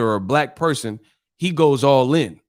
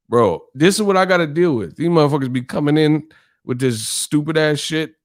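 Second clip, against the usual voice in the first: ordinary delivery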